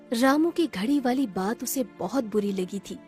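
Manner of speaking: ordinary delivery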